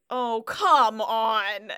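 loud, drawn-out protest; exasperated groom. Retold or imitated. Retelling a groom's reaction to the gatecrasher, loud exasperation. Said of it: "and he says".

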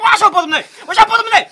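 very loud, high "Waar is jouw portemonnee?"; shouting kids. Retold or imitated. Kids shouting at a cyclist for his wallet, loud high yell. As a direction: bellow the demand.

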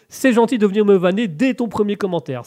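ordinary delivery

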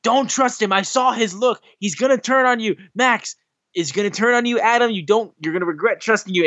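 Roddy warning Adam, in a high frantic voice. Retold or imitated. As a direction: squeak out a panicked warning to a friend. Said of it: "It's like".

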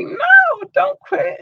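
high shouted plea, no don't quit; fans urging. Retold or imitated. Listeners begging host not to quit, high shouted plea. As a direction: cry out like a fan begging him not to quit. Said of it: "y'all be like".

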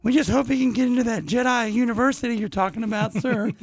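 high pleading mother voice; Shmi voice. Retold or imitated. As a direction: keep the high pleading mom voice. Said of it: "Shmi is like".

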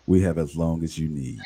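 low, smooth radio voice; deep smooth voice. Pretend. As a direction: drop it low and velvety. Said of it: "I see you use your voice all the time".

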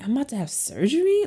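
high-pitched incredulous question; retold disbelief. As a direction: replay your shock at the news. Retold shock at surgery news.

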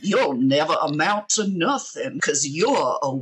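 slow, lightly drawled Southern rooster bluster; a hint of a pompous old Southern judge; Foghorn Leghorn voice. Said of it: "talks like Foghorn Leghorn".

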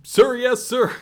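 barked sir yes sir. Acted. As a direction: shout it back even harder. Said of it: "I can't hear you".

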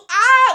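very high, loud imitated shriek; crazed animal-like "ah"; weird scream. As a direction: mimic a wild man's screech. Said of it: "weird noises and screaming and shit".

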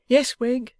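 suddenly roused from abstraction; abstracted. A narrator's reading. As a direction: answer as if pulled out of a daydream. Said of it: "coming out of a fit of abstraction with a sigh".

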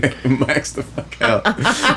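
real laughing disbelief. Real, not acted. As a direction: drag it out while cracking up. Cracking up at co-host's joke.

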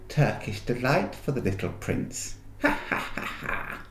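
leering dwarf with a breathy laugh; mocking dwarf. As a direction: sneer it, then a nasty little laugh. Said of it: "He grinned in a repulsive manner".

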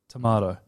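tomato said "to-MAH-to"; long ah in the middle of tomato. Shown as a demonstration. Telling co-host their own version, tomato as "to-MAH-to". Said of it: "Okay, so I'm dance".

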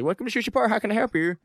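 cartoon Chinese restaurant phone voice. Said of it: "that's just the classic South Park".

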